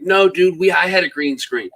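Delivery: high, loud imagined objection; mock protest. Host imagining a viewer correcting him, mock protest.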